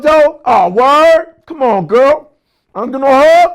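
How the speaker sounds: building from plain to high, loud pleading; mock needy guy pleading for a hug; whiny guy begging a hug. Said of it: "They wanna be like".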